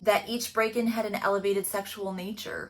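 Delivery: ordinary talk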